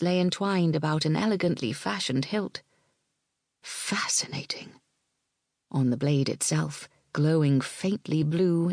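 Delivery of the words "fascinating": whispered. A narrator's reading. Malgwyn's awed inner thought.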